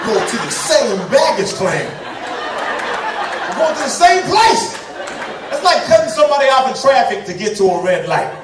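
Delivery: loud high rant; exasperated loud rant about rushing passengers. Real, not acted. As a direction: rant at people rushing off the plane. Ranting about plane passengers.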